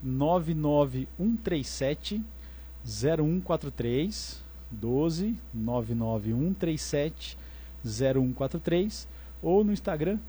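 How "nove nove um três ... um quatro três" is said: slow, careful dictation of digits; slowly. Real, not acted. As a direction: spell out each number slowly so it can be written down. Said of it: "vou falar devagarzinho pra você conseguir anotar".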